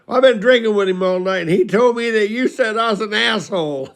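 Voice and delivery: high, loud mock-weepy complaint; put-on tearful protest; imitated bawling grievance. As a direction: bawl out a hurt drunk's accusation. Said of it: "And he's bawling".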